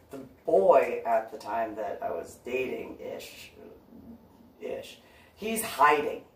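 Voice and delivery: ordinary talk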